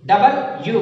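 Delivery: ordinary delivery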